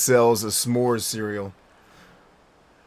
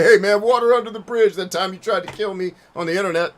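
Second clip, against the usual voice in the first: mock casual; 7 semitones higher, 7 dB louder than usual. Mock call to troll.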